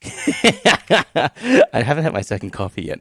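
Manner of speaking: laughing. Real, not acted. Laughs while explaining.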